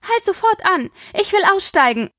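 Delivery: high, urgent plea to stop the car; panicked. A narrator's reading. Aspen begs her mother to stop the car, high panicked plea. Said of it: "Panisch rüttelte sie an der Tür".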